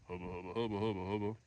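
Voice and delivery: tender hushed "hubba" murmur; hushed babble. Real, not acted. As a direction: breathe the "hubba" almost silently. Repeated much softer.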